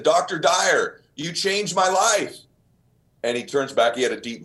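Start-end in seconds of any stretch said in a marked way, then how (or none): 0.0-1.0 called out higher and louder, slowing on life; a young man thrilled to meet his hero; shouting his thanks to a passing runner; eager young fan. Retold or imitated. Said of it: "I said". 1.1-2.4 eager thanks. Retold or imitated. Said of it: "I said".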